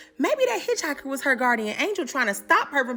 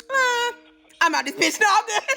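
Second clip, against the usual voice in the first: comic refusal; 8 semitones higher than usual. Retold or imitated. Navy man refusing her offer, higher and amused. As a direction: turn her down flat, amused.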